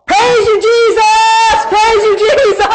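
high, full-out shout of praise; imitated praise shout. Caleb obeying Dad and praising God, exuberant shout. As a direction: shout it joyfully, as the eager child.

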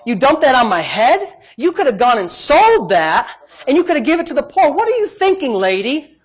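hammed-up rebuke of a foolish woman; mock scolding. Preacher imagines Jesus scolding the woman, hammed-up rebuke. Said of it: "Maybe Jesus is gonna scold her. Say".

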